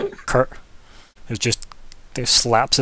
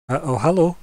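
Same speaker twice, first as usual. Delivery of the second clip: nervous greeting; 5 semitones higher, 4 dB louder than usual. Acted. Nervous young guard greets.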